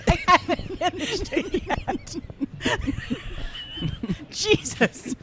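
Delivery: high, laughing protest; real laughter. Real, not acted. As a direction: squeeze the words out through giggles. Cracking up over interrupted question.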